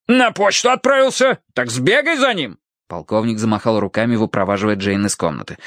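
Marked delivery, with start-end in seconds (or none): 0.1-2.6 high, exclaiming colonel; impatient order. A narrator's reading. Colonel sends the maid after the butler, impatient high shout. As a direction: send her off with an urgent shout.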